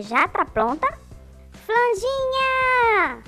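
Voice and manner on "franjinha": long drawn-out call, pitched high. Acted. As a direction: call his name across the lab. Calling for absent inventor.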